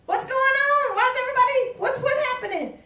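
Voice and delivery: high, bewildered voice of the blind beggar; blind man's confused call. Acted. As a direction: play the confused man by the road. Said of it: "The man sitting there".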